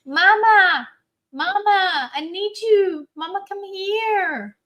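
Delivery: high, loud whiny calls for mama; toddler calling mom. Retold or imitated. Mother imitating her toddler calling at dawn, high and loud. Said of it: "Bonnie woke up at four forty-five today saying".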